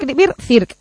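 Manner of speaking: ordinary delivery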